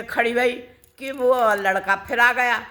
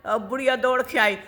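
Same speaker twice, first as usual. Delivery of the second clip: usual speaking voice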